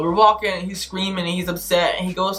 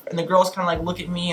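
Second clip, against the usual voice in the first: usual speaking voice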